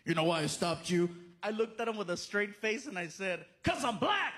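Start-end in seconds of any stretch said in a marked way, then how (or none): 0.1-1.1 low, mildly menacing cop voice; mean cop. Retold or imitated. Cop at the car window to the driver, mock mean. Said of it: "cop came to the car all mean". 3.6-4.3 high-pitched mock outburst; cheeky exclamation. Driver answering the cop with a straight face, cheeky.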